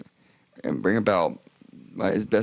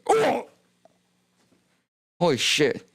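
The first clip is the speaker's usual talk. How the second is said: pained yelp; 9 semitones higher, slower than usual. Retold or imitated. Reenacting the catheter pain.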